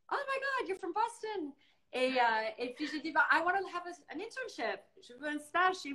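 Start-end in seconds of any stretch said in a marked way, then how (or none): 0.1-1.5 much higher, thrilled squeal; delighted surprise. Retold or imitated. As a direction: gush like you found a fellow Bostonian. The editor's delighted reaction. (la rédactrice, ravie qu'elle vienne de Boston, s'exclame très haut)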